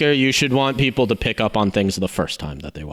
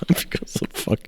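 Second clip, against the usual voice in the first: voiceless, wheezy laughing words; too tickled to get the words out; soft, helpless laugh-talk; breaking up laughing; 4 dB quieter than usual. Real, not acted. Cracks up at Beatles joke.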